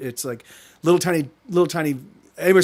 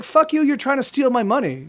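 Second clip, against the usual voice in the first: retold telling-off; 7 semitones higher than usual. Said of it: "I just kinda lit into him".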